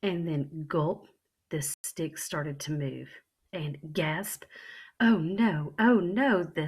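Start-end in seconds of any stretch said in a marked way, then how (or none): 5.0-6.4 louder, rising alarm; startled panic. Acted. Child sees stick move.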